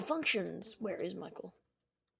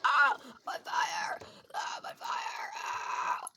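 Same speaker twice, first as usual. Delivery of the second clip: mock burning cry; 6 semitones higher, slower than usual. Chica burning up.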